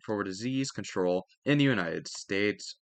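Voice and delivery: ordinary talk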